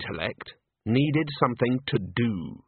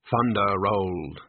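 usual speaking voice

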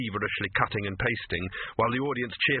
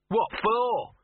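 high-pitched, incredulous protest; indignant; 11 semitones higher, 3 dB louder than usual. Acted. Dog told to throw a stick, indignant. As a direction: balk at it as a grumpy dog. Grumpy dog balks at fetch.

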